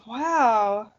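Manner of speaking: normal talking voice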